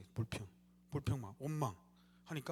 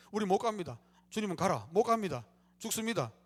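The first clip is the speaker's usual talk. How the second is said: fearful refusal; 3 semitones higher, 7 dB louder than usual. Retold or imitated. Spies refusing to enter Canaan.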